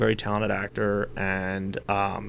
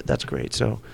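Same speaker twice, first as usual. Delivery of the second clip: hushed act; 3 semitones higher, 6 dB quieter than usual. Retold or imitated. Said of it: "I did my whole act at that tone".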